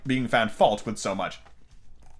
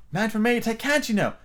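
high-pitched, ready answer; ready reply; 11 semitones higher than usual. A narrator's reading. Alice answering the Queen's subtraction sum, readily. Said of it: "Alice replied very readily".

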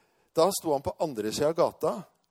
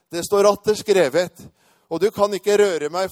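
high, loud scripture retort; defiant quoting; 5 semitones higher, 10 dB louder than usual. Retold or imitated. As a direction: hurl the verse back loudly. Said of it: "jeg har fortsatt å sitere".

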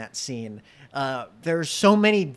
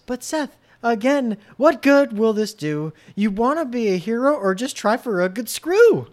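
higher sung Evan voice; 7 semitones higher, 5 dB louder than usual. Pretend. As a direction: sing as Evan in a musical. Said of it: "you changed your voice for Evan".

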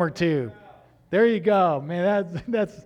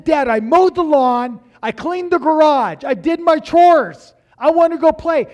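loud protest; 10 semitones higher, 11 dB louder than usual. Retold or imitated. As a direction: plead your case, louder and louder. Kid protesting loudly to dad.

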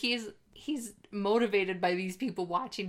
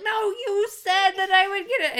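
whiny Gaz voice; 14 semitones higher, 8 dB louder than usual. Retold or imitated. Acting out Gaz's complaint.

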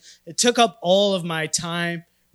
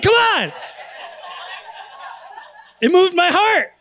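high, loud comic protest; mock exasperation; 11 semitones higher, 4 dB louder than usual. Husband defends his tears to wife, high mock exasperation. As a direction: protest at high pitch, played for laughs.

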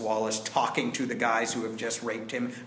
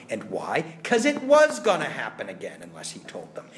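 usual speaking voice

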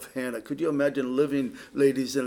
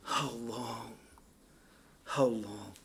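much softer and lower, aching lament; anguished hush; 5 semitones lower, 10 dB quieter, slower than usual. Shown as a demonstration. The preacher voices the psalmist's anguish, hushed and low. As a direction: breathe it out in anguish. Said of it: "an expression of great Anguish".

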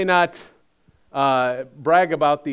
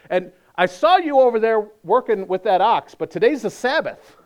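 louder, higher accusing call-out; mock scolding; 6 semitones higher, 6 dB louder than usual. Preacher voices legalist scolding convert, raised and accusing. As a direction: catch them sinning, raised and pointed.

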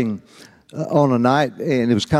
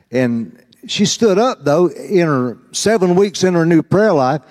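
ordinary delivery

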